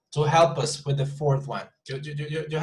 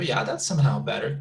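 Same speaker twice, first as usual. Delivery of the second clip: ordinary talk, nothing marked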